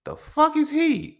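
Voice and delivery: very high, slow drawn-out shock; stunned disbelief. Retold or imitated. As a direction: stretch it out in amazement. Adults staring at the child.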